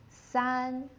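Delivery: San held high and level; first tone san. Shown as a demonstration. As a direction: hold San high, never dropping. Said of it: "first tone again, never drops".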